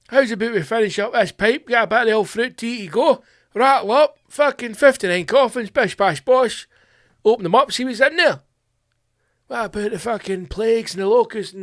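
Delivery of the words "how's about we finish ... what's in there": higher, laddish pub patter; voicing a stoned Glasgow-style lad. A lad in a hookah bar talking his pal into it, higher and laddish. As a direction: play the stoned mate chatting up his pal.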